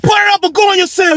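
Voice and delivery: very high-pitched lady guard voice; imitated guard order. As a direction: mimic a woman calling out an order. Imitates guard repeating her order.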